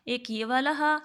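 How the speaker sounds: ordinary talk, nothing marked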